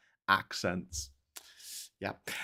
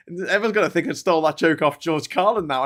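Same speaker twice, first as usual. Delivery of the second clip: ordinary talk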